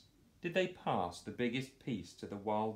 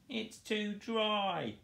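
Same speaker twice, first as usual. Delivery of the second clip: much higher, slow whiny sob; mock sobbing; 13 semitones higher, much slower than usual. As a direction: whimper it like a sulky boar. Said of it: "sobbed Boris".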